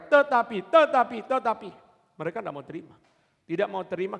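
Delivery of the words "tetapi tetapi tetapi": very high, loud shouted protest; crowd shouting back. Retold or imitated. Crowd shouts back at Pilate, high loud protest. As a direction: shout them down, each word a blow. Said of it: "Maka mereka".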